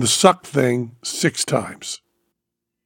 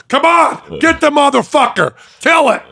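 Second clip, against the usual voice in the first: high-pitched hollered battle cries; frenzied egging on. Retold or imitated. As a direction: whip the doctor into attack, voice way up. Retold patient egging doctor on.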